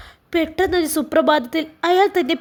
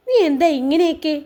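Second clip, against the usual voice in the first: weakened voice. A narrator's reading. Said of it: "ഷിബിലിയുടെ ശബ്ദം ദുർബലമായി".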